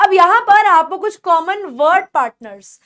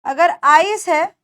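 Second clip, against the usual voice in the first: ordinary delivery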